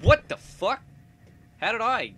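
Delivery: high, loud shocked outburst. Real, not acted. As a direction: blurt it out in disbelief. Shocked by sudden portal.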